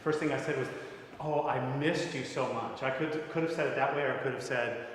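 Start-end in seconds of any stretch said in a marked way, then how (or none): 1.2-2.8 "missed" stretched as the key word; slow line with the weight on "missed"; stress on MISSED. Shown as a demonstration. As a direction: lean on "missed". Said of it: "giving different stress to words".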